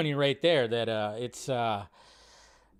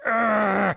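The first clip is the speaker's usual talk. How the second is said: higher mock growl; imitated growl; 5 semitones higher, 4 dB louder than usual. Imitating the character's face in the trailer, mock growl. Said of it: "He's just like".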